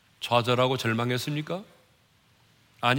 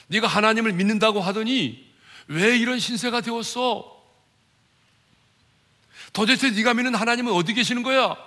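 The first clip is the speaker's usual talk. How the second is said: high, raised-voice jeering accusation; mocking reproach; 6 semitones higher, 4 dB louder than usual. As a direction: challenge them scornfully, pitched up. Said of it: "조롱하고 비난한 거예요".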